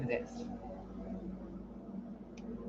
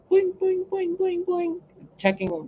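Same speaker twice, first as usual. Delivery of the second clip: high, bouncy boing boing; cartoon spring noises, light and playful; mock boing sounds; 14 semitones higher than usual. Bouncing through avatar list, sound effect.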